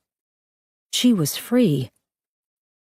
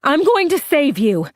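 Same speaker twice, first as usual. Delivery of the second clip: screaming back; 9 semitones higher than usual. Acted. Claire screaming reassurance back to her sister, high shout. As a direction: shout comfort over the fire. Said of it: "Claire screamed back".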